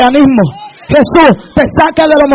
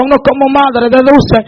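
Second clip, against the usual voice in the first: ordinary delivery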